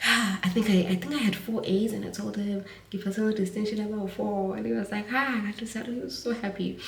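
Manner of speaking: fighting back real tears while remembering; tearful. Real, not acted. Granddaughter telling viewers about grandfather, tearful. Recalling grandfather's last call, crying.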